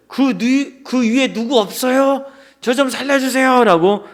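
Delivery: acted desperate call for help, not full volume; desperate shout. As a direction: plead upward with the last of your strength. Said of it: "필사적으로 외쳤죠".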